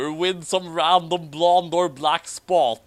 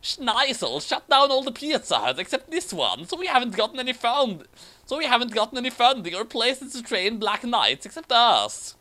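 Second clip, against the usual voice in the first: higher voiced girl knight complaining; mock female knight; 8 semitones higher than usual. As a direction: keep her a bit high and earnest. Reading female knight's dialogue.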